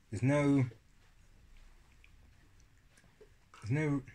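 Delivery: talking while eating yogurt; chewing between words; mouth full. Real, not acted. Seen 4: Spoon repeatedly goes to mouth from yogurt tub while he talks.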